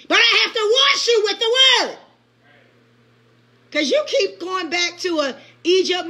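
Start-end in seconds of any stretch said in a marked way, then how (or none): 0.1-2.0 preaching shout. Acted. Preacher shouting God's words.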